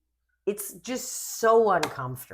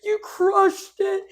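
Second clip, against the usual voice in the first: much higher, slow, gushing mock squeal; gushing fans; 7 dB louder, slower than usual. Imitating old friends praising the show, high mock squeal. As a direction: imitate excited girls squealing. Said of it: "they were like".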